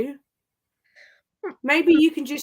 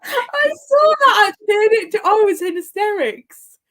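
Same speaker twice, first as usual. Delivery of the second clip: laughing; 10 semitones higher, 7 dB louder than usual. Real, not acted. Laughing hard at the clip.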